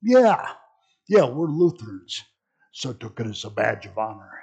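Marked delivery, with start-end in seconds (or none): 0.0-2.2 proud acceptance. Retold or imitated. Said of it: "and Lutheran said".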